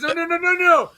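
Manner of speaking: hammed-up wife waving it off; wife hurriedly denying to the porter; wife protesting. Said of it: "she goes".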